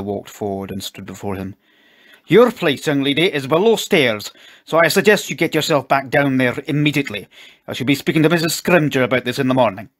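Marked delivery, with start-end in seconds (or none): 2.3-9.9 loud, raised, stern dressing-down; angry scolding. A narrator's reading. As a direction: put the young servant firmly in their place. Furious father scolds the maid.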